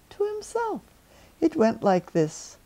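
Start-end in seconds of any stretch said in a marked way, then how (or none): none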